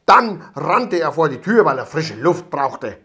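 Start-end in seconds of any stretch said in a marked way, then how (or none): none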